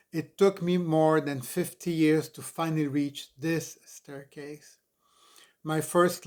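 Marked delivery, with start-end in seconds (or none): none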